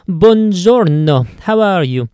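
cheerful, slow Italian deli-man greeting; friendly Italian clerk welcoming a regular; Italian shopkeeper accent. Acted.

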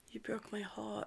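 very soft whispered line; imitated heartbroken whisper; hushed quote. Retelling a character's line to co-host, whispered. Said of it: "She's like".

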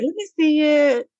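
playful innocent request; fake sweet. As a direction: sweet-talk him into handing it over. Sweetly asking for chain.

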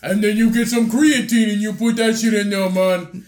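botched Austrian impression with a Jamaican lilt; mock accent, accidentally Jamaican; failed Arnold accent turning Jamaican. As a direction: coach the recipe in a badly faked Jamaican-sounding accent. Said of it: "I sound like Jamaican".

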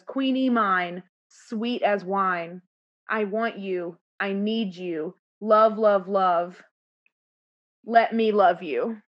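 slow half-sung love plea; slow swooning quote. Retold or imitated. Host imitating Burr's besotted plea to Queenie, slow. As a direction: melt into the lines slowly. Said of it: "he goes".

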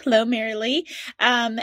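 normal talking voice

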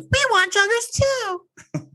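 very high camp squeal. Retold or imitated. Mimicking her voicing the men who want joggers, squeaky camp voice. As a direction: exaggerate it into a high, camp imitation.

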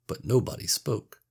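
usual speaking voice

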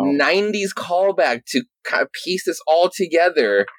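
high-pitched, laughing disbelief; amused exasperation. Real, not acted. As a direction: curse the writer's cheek through a laugh. Amused exasperation at writer's callback.

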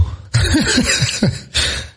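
a breathy, high little laugh at himself; airy laugh. Real, not acted. Amused by his own Star Trek joke, soft laugh. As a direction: laugh it off quietly.

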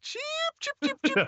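very high mocking chicken cheeps; cartoon bird voice. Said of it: "are you a big chicken".

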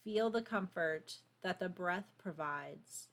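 calm, unhurried meditation voice; guided meditation. Acted. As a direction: guide them gently into stillness. Leads a grounding meditation.

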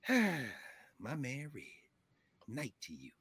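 sigh, then a very slow, soft goodbye; quiet, drawn-out parting line; hushed acted farewell. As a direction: draw it out softly, imitating a movie character. Acting out movie character's parting line.

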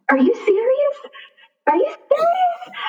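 high, squeaky incredulous question; retold stunned disbelief. Boyfriend sees the onesie on camera, high stunned disbelief. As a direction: imitate his stunned, high-pitched disbelief. Partner reacts to pregnancy news.

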